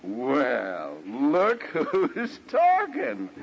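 mock-sweet marital comeback; sarcastic retort. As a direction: throw the criticism straight back, mockingly. Acted defensive retort to wife.

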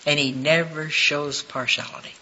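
ordinary talk

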